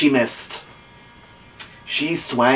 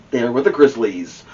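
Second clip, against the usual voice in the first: plain reading voice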